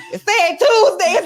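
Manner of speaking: high, slow, laughing through the words; cracking up mid-complaint. Real, not acted. To co-hosts, laughing about the package date.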